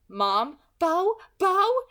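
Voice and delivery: high, loud panicked calling; panicked mother. Acted. Frantic mother calling her child's name, high and loud. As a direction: cry out for your child in panic. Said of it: "It was the sound of panic".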